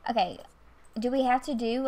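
ordinary talk, nothing marked

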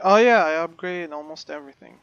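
usual speaking voice

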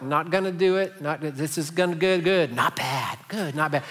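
hammed-up but mild George Bush bit; George Bush impression. Preacher doing Dana Carvey's George Bush, lightly voiced. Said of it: "I'm doing a terrible imitation".

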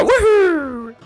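high, excited Italian plumber cheer; Mario voice. Acted. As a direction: bounce in as a gleeful Mario. Mario figure enters the game.

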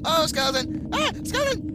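sudden high yelp of surprise; startled yelp. Real, not acted. Gamer spotting a skeleton, yelping in surprise. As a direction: jump at the skeleton with a cry.

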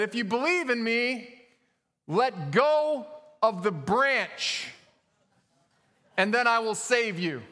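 high, slow, solemn call from above; booming voice. Acted. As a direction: pronounce the command from on high. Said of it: "a booming voice spoke up".

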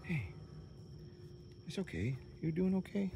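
high, soft reassuring coo; tender pet talk. Real, not acted. Owner soothing her shaking goat, soft high coo.

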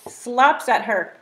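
usual speaking voice

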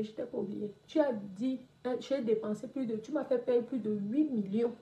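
ordinary talk

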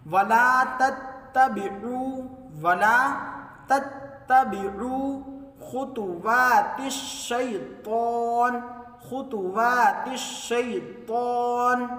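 slow, drawn-out tajweed chant; chanted Quranic recitation. Shown as a demonstration. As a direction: recite melodically, stretching the vowels. Recites verse.

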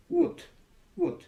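Would said wood, no L; Would with the L left out, said wood; silent L. Shown as a demonstration. Teacher repeats Would slowly with a silent L. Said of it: "Böyle söyleyeyim".

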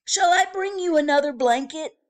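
fast, bright bellhop offer; quick helpful offer. Acted. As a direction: rattle it off eagerly.

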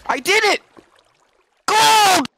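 a very high, loud joyous shout; triumphant yell. Real, not acted. Finding gold triumph.

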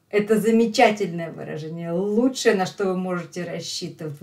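ordinary delivery

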